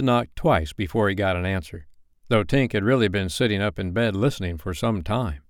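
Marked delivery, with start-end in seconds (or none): none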